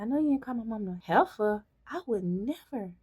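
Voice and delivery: playful imitation of a sassy teen; sassy movie quote. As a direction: fire it back with attitude. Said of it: "She said".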